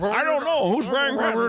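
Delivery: high put-on voice of an onlooker; high-pitched feigned ignorance; clueless bystander. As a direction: play dumb in a high silly voice.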